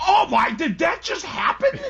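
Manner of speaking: high pitched mock shout of disbelief; replaying his shocked yell at the screen; retold screaming. Dad reenacting yelling at a shocking scene. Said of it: "you're screaming at the top of your lungs".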